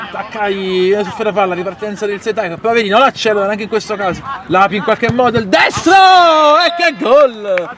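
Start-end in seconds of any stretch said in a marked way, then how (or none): 5.4-7.8 high, stretched call of a goal; excited goal shout. Real, not acted. Goal scored, live commentary. (grido di gol)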